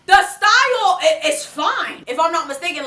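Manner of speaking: usual speaking voice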